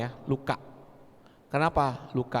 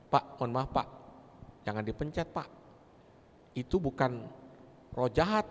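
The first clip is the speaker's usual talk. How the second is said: gentle polite plea; 4 semitones lower, 6 dB quieter than usual. Retold or imitated. Politely stopping the praying man.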